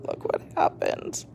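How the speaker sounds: soft unvoiced whisper. A narrator's reading. Whispered aside.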